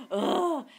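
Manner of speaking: sharp gasp, then a loud "ugh"; acted disgusted groan; exasperated gasp and groan. Exasperated reaction to the customer.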